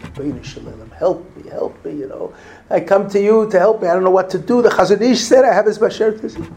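woman at the Wall begging through tears; retold tearful plea. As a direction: beg heaven for help in an anxious, climbing rush. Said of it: "and she's crying".

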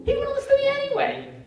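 high, loud exasperated outburst; loud high-pitched aside; emphatic exclamation. Real, not acted. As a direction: shoot the voice way up in comic protest. Father exclaiming about son ignoring advice.

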